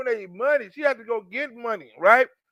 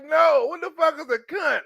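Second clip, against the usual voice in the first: baffled insistence; 6 semitones higher than usual. Retold or imitated. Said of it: "I'm like".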